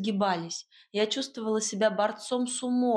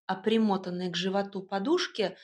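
usual speaking voice

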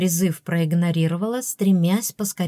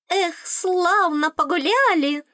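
high, slow fairy musing; muttering fairy; 11 semitones higher, slower than usual. A narrator's reading. Worn-out fairy after the party, slow high-pitched musing. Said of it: "пробормотала Аскалка".

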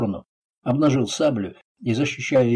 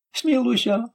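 high-pitched plea for mercy; begging; 12 semitones higher, slower than usual. A narrator's reading. Thug under a raised sabre, begging. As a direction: plead in a much higher voice. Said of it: "взмолился тот".

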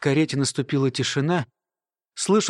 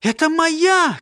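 high, urgent acted cry; narrator's contained high shout; 12 semitones higher, 4 dB louder, slower than usual. A narrator's reading. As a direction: call out in alarm, pitched well up. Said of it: "крикнула Ирма".

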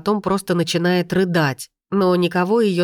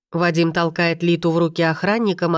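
ordinary delivery

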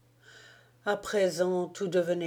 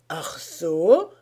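mock surprise; 5 dB louder than usual. A narrator's reading. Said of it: "s'exclama le Feldwebel".

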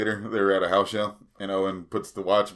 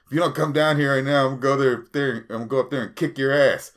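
louder, higher mock threat; threatening caller; 6 semitones higher, 5 dB louder than usual. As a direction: bark a threat down the phone. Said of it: "and he goes".

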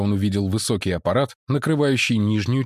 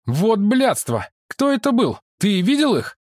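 angry Chen Ren; 11 semitones higher, 4 dB louder than usual. Acted. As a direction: rage at whoever wrecked his ship. Chen Ren furious about ship. (Чэнь Рэн в ярости, громко и высоко)

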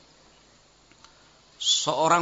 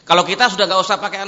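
quick excited aside; 7 dB louder, faster than usual. Real, not acted. Preacher jokes about accepting at once.

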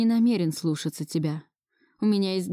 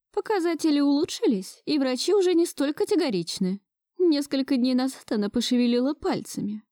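higher-pitched grandmother, a touch tearful; understated tearful telling, voice raised in pitch; nearly tearful; 7 semitones higher than usual. A narrator's reading. Grandmother recounting the patient's progress, nearly in tears. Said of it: "Чуть ли не со слезами на глазах рассказывала бабушка".

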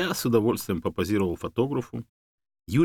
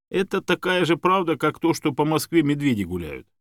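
higher, lively dismissal; joking rebuttal; 6 semitones higher, 4 dB louder than usual. A narrator's reading. Man comparing the rumour to bears in Moscow, joking. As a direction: debunk it with good humour.

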